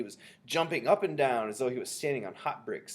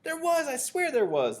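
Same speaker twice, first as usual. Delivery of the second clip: high, loud, indignant protest; shouting back; 7 dB louder, slower than usual. A narrator's reading. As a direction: insist desperately at full voice. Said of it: "the man was shouting back".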